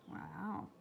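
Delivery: plain reading voice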